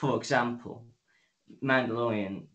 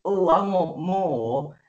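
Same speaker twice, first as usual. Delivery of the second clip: "Oh, I want more" slow, higher and louder; mock greedy plea; 6 semitones higher, 5 dB louder, much slower than usual. Reviewer mocking a character who wants more fighting, slow and hammed-up. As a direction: ham up a greedy, whining voice.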